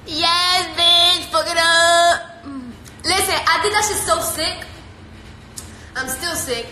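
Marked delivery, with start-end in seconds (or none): 0.1-2.4 loud sing-song cheer; hype cheer. Real, not acted. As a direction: hype it up, louder.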